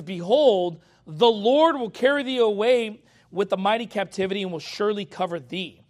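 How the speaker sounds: loud proclaiming read; scripture reading. Acted. Preacher reading Isaiah to his congregation, proclaiming. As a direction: declaim the verse to the church.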